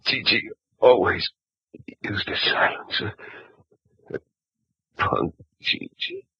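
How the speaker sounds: faint, breathless dying words; dying gasp. Acted. Dying gangster names his killer, breathless and fading. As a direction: fade out as life slips away.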